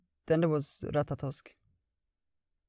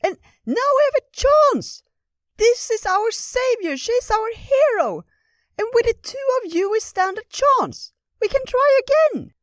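very high, loud squirrel cheering; excited squirrel; 9 dB louder than usual. Acted. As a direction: squeal it like an eager little squirrel. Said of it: "too excited to stop".

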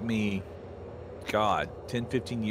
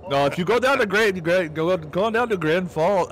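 higher, louder North Dakotan lilt; North Dakota accent; 5 semitones higher, 4 dB louder than usual. Retold or imitated. Mimicking North Dakotans giving directions, lilting accent. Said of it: "now I'm switching to".

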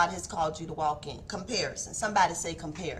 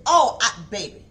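ordinary talk

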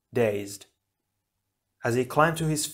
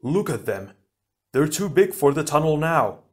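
dismayed exclamation, rising higher; cried out; 4 semitones higher, 3 dB louder than usual. A narrator's reading. Onua warns that the plan is failing, cried out in alarm. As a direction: build to an alarmed cry. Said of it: "Onua cried".

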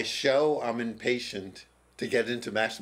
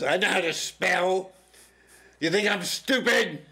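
angry retort; 9 semitones higher, 6 dB louder than usual. Retold or imitated. Guard snaps at teacher.

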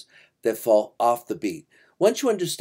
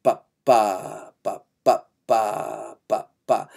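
low "ba" syllables in short-long-short rhythm; syncopated rhythm syllables; 4 semitones lower, much slower than usual. Shown as a demonstration. Teacher demonstrating the commonest syncopation, rhythm chanted on ba. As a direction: sound out the syncopation on ba, holding the middle note. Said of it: "This rhythm is".

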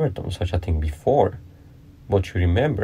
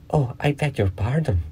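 higher-pitched quick apology, lightly acted; mildly hasty, raised-pitch apology; 7 semitones higher than usual. A narrator's reading. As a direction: apologise in haste, voice lifted a little. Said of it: "cried Alice hastily".

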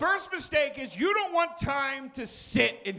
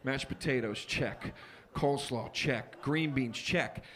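low, soft checklist mumble; muttered checklist; 10 semitones lower, 6 dB quieter than usual. Retold or imitated. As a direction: tick items off under your breath. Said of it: "I'm like".

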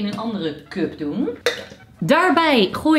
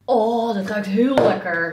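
normal talking voice

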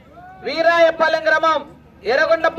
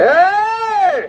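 high drawn-out "hey" cry; urging yell; 5 semitones higher, much slower than usual. Real, not acted. Commentator urging on racing bulls, high yell.

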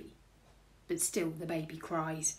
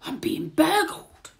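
high, loud, slow exclamation; shocked burglar, last word stretched; astonished burglar; 10 semitones higher, 8 dB louder, slower than usual. A narrator's reading. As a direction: cry it out in outrage. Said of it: "said Burglar Bill".

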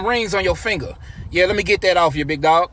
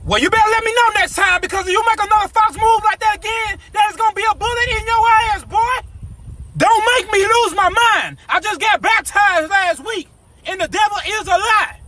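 angry rant; 8 semitones higher than usual. Acted. Robber lectures victim about false moves, high angry rant. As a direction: scold him like you're about to snap. Robber ranting with gun threat.

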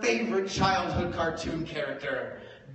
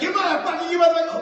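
loud, much higher yell; mock fury; 12 semitones higher, 10 dB louder, much faster than usual. Drunk friend yelling at the comic, mock furious. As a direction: spit it out twice.

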